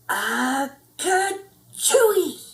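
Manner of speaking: slow, drawn-out sneeze; acted sneeze. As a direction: stretch out a big silly sneeze.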